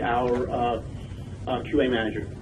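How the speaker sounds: ordinary talk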